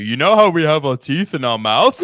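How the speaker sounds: loud, incredulous mimicry; you must be drunk, mocking; mocking repeat. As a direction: throw it back in loud disbelief. Mocks friend's odd statement.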